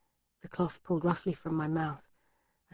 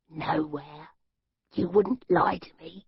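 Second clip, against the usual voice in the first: captor's voice. Acted. Captor's menacing voice.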